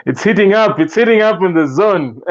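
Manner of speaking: high, loud, laughing commentary; laughing excitement. Real, not acted. Host enjoying two fans arguing, laughing and excited. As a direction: crow it through real laughter. Amused as the debate heats up.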